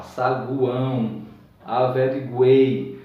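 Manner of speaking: saguão and averiguei said slowly, each vowel sounded; slow syllables. Shown as a demonstration. Teacher pronouncing every vowel in saguão, averiguei. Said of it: "Você pronuncia todas as vogais".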